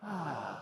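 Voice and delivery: soft long exhale; big sigh. Shown as a demonstration. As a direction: sigh it out softly. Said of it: "So five".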